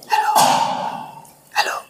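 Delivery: soft whisper, barely voiced; whispered demo. Shown as a demonstration. Guide shows the secret chamber, whispering. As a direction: whisper so the enemy can't hear. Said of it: "मी अगदी हळू मनामध्ये बोलतो".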